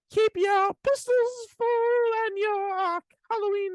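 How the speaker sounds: high squeaky leprechaun voice. Pretend. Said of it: "like a scary little leprechaun".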